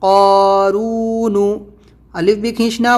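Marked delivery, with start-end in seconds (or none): 0.0-1.5 slow spelled-out recitation. Shown as a demonstration. Teacher spelling out a word for students, slow and sing-song. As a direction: spell each letter and vowel slowly, chant-like.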